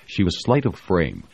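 ordinary delivery

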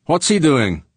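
high, loud demand; urgent question. A narrator's reading. George asking pilot about attacker, loud and urgent. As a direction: call it out urgently.

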